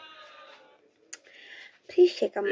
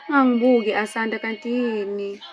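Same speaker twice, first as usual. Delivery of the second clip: calling out; 3 semitones higher, 8 dB louder than usual. Real, not acted. Calling out to Asanda.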